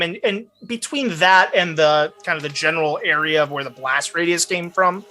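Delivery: ordinary talk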